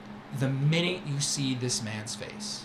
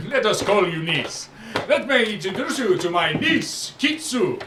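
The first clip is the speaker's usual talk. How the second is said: louder, sweeping showman voice; grand merchant boast; 5 semitones higher, 6 dB louder than usual. Acted. Merchant Syrah boasting to the young friend, loud and showy. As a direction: boast like a flamboyant trader. Syrah introducing his niece.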